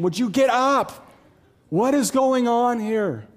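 high, loud exasperated yell; screaming and yelling. Acted. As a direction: yell at a sleeper to wake up. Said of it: "screaming at him and yelling at him".

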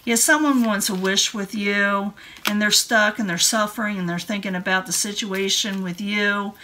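ordinary talk, nothing marked

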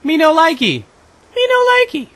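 put-on high childish whine, climbing to falsetto; mock baby-talk complaint. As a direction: pout it in a squeaky voice.

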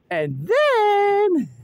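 high, loud, held-out THEN; drawn-out build-up. Real, not acted. [name] building up to her plan, dramatic drawn-out THEN.

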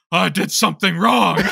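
panicked confession. Retold or imitated.

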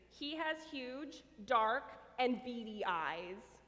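slow, drawn out, ominous; slow eerie gossip about the newcomer; spooky slow description. Acted. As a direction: linger on each word to spook them. Aunt describing spooky eyes.

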